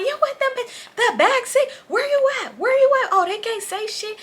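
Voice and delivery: very high mock screech; squealed, outraged demands; irate girlfriend. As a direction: imitate a girlfriend going off over the phone. Voicing girlfriend shrieking on phone.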